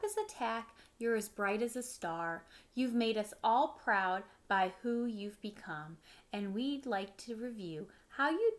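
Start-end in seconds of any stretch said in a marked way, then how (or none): none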